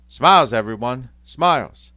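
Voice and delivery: loud, bright urging; rally the children to smile; forced cheer. Acted. Mother urging brave faces.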